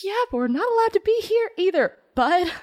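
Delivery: high, quick retort; lightly voiced Cammy. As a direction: snap back high and fast. Cammy whispers back.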